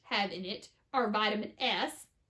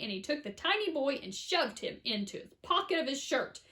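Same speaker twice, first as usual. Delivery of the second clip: ordinary delivery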